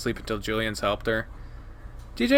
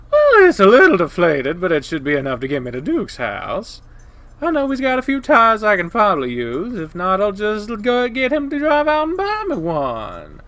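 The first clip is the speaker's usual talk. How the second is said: blaring, higher-pitched coyote woman; loud, obnoxious Janice; 8 semitones higher, 6 dB louder than usual. Acted. Janice turns her attention on the two boys, loud and obnoxious. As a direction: project it brashly.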